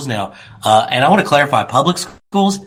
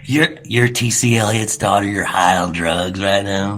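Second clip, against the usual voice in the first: low, drawn-out gossip; voiced rumor; 8 semitones lower than usual. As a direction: pass on the rumor in a slow, lowered voice. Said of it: "that was the rumor".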